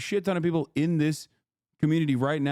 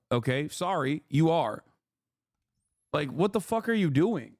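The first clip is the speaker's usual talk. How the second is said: ordinary delivery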